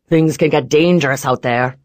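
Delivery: loud rough veiled threat; menacing boss voice. Acted. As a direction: lean on can, warning.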